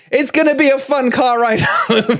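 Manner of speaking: chuckling through the line about a fun car ride; laughing. Real, not acted. Dad laughing about the car ride home with rival-fan sons.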